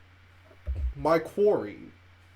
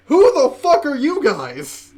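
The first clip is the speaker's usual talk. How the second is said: loud, high demand at intruders; demanding shout; 8 semitones higher, 8 dB louder than usual. Acted. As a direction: bark the challenge at the strangers. Player confronts strangers at door.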